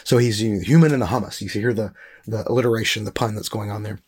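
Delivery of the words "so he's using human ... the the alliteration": usual speaking voice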